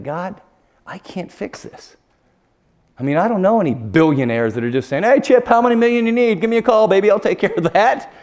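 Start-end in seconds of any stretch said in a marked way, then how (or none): 0.0-2.0 choked, breathless whisper to God; barely voiced, near tears; broken whispered prayer; tearful whisper. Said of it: "I was bawling". 5.0-8.1 loud, high, breezy big-shot voice; mock smooth tycoon; breezy rich guy. Imagined billionaire calling him.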